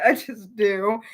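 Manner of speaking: ordinary talk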